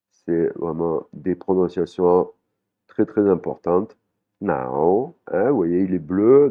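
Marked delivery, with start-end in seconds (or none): 4.4-5.1 bend "Nao" down and swing it up. Shown as a demonstration. Teacher shows the blue word, contoured tone on "Nao". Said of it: "une intonation qui monte et qui descend".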